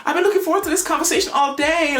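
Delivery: mock-gushing, very high-pitched squeal; excited imitation. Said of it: "Amber's proper, proper excited to speak to Paul".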